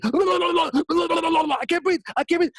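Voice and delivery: high, strained gasping cry of a drowning man; drowning panic. Retold or imitated. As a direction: choke it out as if held under water. Drowning man gasping under water.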